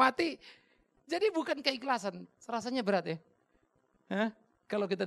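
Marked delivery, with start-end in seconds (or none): none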